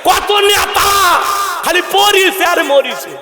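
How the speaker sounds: high, slow and loud preaching; preaching cry. Real, not acted. Preacher warns the crowd about arrogant leaders in a rising cry. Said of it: "preaching at full force".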